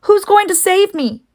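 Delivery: very high, eager pig; eager high question. Acted. Wilbur eagerly asks Charlotte, very high. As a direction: grab at any rescue.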